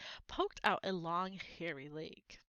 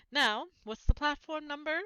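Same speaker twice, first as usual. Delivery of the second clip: a hint of a motherly voice, higher; busy mum asking, slightly raised; lightly voiced mother; 6 semitones higher, 5 dB louder than usual. A narrator's reading. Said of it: "said the boy's mother".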